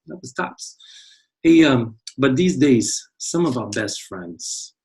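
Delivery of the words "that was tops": much softer, almost under the breath; soft repeat. Shown as a demonstration. Teacher repeats his praise of the star, much softer. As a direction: drop the voice for That was tops.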